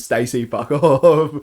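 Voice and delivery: loud, slow drawn-out mock yell; retold yell. Player yelling at the ghost by name, drawn-out mock yell. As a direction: stretch the words out as you yell. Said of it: "I'm yelling at this thing going".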